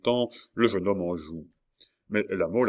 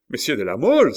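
higher, louder exclamation of surprise; startled cry; 6 semitones higher, 9 dB louder, faster than usual. A narrator's reading. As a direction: exclaim with a jolt. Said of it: "s'écria Coconas avec un soubresaut". (cri de surprise, en sursautant)

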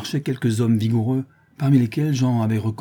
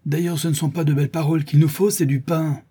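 raised, higher-pitched ringleader's protest; shouted retort; 7 semitones higher, 3 dB louder than usual. A narrator's reading. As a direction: call it out for the mob behind you. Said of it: "cria le charron d'une voix formidable". (protestation criée)

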